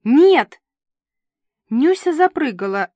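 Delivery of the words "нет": loud, high shout; yelled refusal. A narrator's reading. Director shouts down the host's earpiece, yelling louder. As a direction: yell the NO at full force. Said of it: "заорала в ухе".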